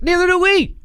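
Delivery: high, sing-song me-too reply; mocking Democrat. Mocking corporate Democrats agreeing with Republicans, high and sing-song. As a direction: chirp it back like a yes-man. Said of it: "that's why they sound like this".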